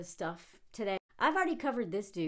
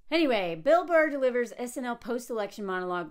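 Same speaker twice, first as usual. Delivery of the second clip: ordinary talk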